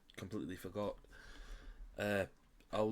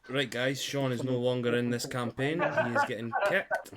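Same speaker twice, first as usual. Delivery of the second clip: mock outrage; 4 semitones higher, 10 dB louder than usual. Real, not acted. GM mock-threatens to kick player.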